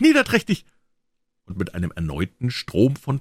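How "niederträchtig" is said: niederträchtig even higher and louder, falling; louder spiteful cry. A narrator's reading. Becky repeats niederträchtig at Tom, even higher. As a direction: top the first niederträchtig with a shriller cry. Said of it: "stampfte sie mit dem kleinen Fuße auf und rief".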